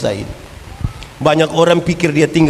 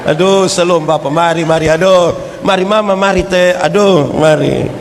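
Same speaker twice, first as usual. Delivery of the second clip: flustered welcome. Retold or imitated. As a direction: gush over the visiting pastor. Flustered couple welcoming pastor.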